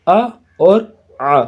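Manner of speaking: ا with a plain glottal stop, then ا with a throaty ع; clear letter sounds. Shown as a demonstration. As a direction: set the hamza against the ع, slowly and loudly. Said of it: "ا کی آواز کیسے نکلے گی؟".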